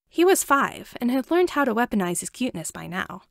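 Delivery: ordinary delivery, nothing marked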